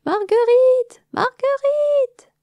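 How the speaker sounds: very high, loud, drawn-out calls; frantic calling. Acted. Dame Églantine running from room to room, calling the missing queen. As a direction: call her name through the hive, frantic. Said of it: "en criant".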